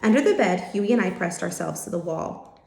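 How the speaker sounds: usual speaking voice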